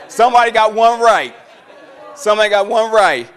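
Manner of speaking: excited call. Real, not acted. Cheering an audience member's right answer.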